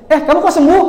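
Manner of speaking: imitated surprised neighbour. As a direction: ask like you can't believe they're well.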